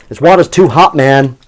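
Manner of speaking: loud, high mock American; hammed-up American complaint; American accent. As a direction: put on a big American accent.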